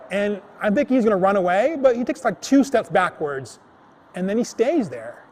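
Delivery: ordinary delivery, nothing marked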